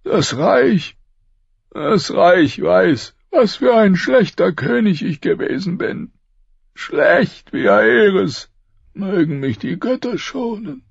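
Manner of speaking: high, strained voice of a dying king. Acted. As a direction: push each word out through pain. Robert's confession continues.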